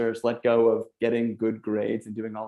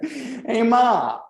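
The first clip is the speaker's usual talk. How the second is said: high, loud mock gangster call; hammed-up wise-guy holler; nineteen-thirties gangster; 13 semitones higher, 4 dB louder than usual. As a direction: imitate an old-time gangster hollering for Ma. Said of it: "sounds like a nineteen thirties gangster".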